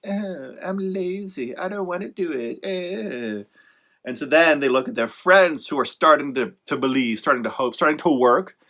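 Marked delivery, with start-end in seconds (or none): 0.0-3.4 slow, soft mock-lazy whine; lazy whiner. Mocking lazy authors who won't market, slow whine. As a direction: drag it out like a lazy slacker. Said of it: "they're like".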